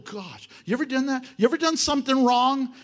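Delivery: normal talking voice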